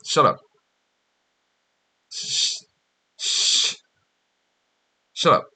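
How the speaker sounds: stammered then flat "shut up" tries; curt dismissive practice takes; trying out shut up. Shown as a demonstration. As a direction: search for the right curt "shut up". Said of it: "This is what we're doing".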